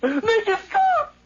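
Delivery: high, rising, frantic cry; desperate plea. Acted. Nephew crying out as the heartbeat torments him. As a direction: beg it to end, near breaking. Killer breaking down.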